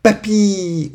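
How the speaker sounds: high-pitched falling call for grandpa; child's cry. A narrator's reading. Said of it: "Et Ario cria". (cri d'enfant aigu)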